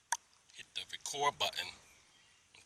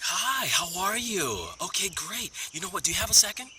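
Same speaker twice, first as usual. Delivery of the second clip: salesy voice; 10 semitones higher, 5 dB louder than usual. Retold or imitated. Salesman to someone at the door, salesy voice. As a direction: turn on the gushing salesman pitch. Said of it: "it's like a salesy kind of voice".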